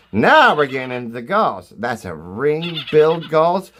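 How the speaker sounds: ordinary delivery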